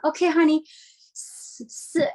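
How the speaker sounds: patient mom demonstrating "S-, s-,"; teaching son the S. Shown as a demonstration. Mother teaching her kindergarten son to say S, coaxing. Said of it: "So I'm like".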